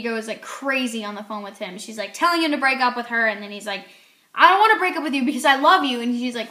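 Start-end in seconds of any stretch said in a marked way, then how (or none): 4.3-6.0 louder, faster urgent protest; pleading boyfriend. Retold or imitated. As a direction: rush it out like a desperate boyfriend.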